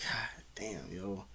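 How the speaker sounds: very soft, low awestruck mumble; hushed admiration. Retold or imitated. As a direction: re-enact being quietly floored. Acting out admiration.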